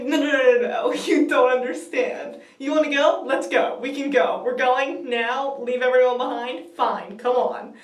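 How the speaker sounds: loud, urgent fan pleading; frantic insistence. Retold or imitated. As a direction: push loudly, phrase after phrase. Begs Doctor to go now.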